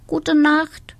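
a sleepy hen's goodnight; neighbouring hen wishing good night. A narrator's reading. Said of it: "wünschte die weiße Henne".

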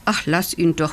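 ordinary delivery, nothing marked

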